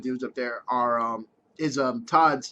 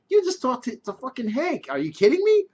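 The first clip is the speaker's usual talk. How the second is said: mock outrage; 9 semitones higher, 4 dB louder than usual. Imagined Walt scolding Skyler.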